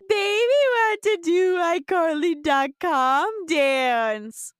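high, drawn-out put-on baby voice; hammed-up demanding baby; Spencer. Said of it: "Spencer eats it and then says".

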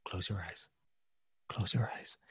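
soft, whispered, insistent; whispered command. Retold or imitated. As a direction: hush her into closing her eyes. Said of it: "Kelly is saying".

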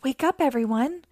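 ordinary delivery

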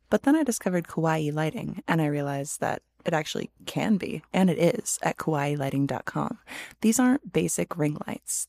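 upbeat sponsor read. Acted. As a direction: sell the product cheekily.

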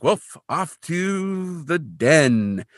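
high, slow, drawn-out announcement; jaunty mock resignation. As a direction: announce a march to face the boss, hammed-up. Kolchak setting off to face boss.

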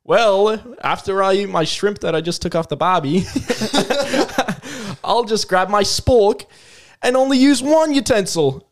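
mock Australian, amused at the start; Australian accent. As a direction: play a baffled Australian ad character. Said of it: "yeah, we'll do Australian".

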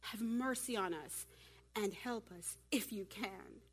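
slow, soft, acted begging; hesitant, drawn-out appeal for mercy; timid plea. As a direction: let the plea trail down meekly. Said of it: "There goes that chicken prayer".

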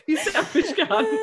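high, slow, giggling jab; teasing her friend through laughter; laughing mock insult. Real, not acted. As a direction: laugh it out as a tease.

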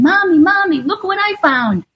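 put-on high, bright child calling for mommy; excited little boy. Mother imitating her son running in to show her something, high excited child voice. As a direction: play the eager kid running in with a find.

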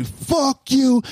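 high-pitched mock crowd shout; imitated crowd yell. Crowd turning on the rapper, imitated yell. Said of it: "get that loud".

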